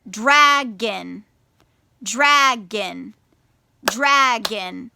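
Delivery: dragon split into two slow beats, drag-on; syllable by syllable. Shown as a demonstration. Teacher counts syllables for children, dragon syllable by syllable. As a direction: clap out dragon, one beat per syllable. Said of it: "practice clapping your syllables".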